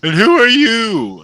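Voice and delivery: very high, loud, theatrical showman voice; flamboyant halfling. Acted. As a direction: gush like a delighted stage impresario.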